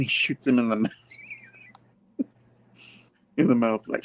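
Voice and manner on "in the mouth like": ordinary delivery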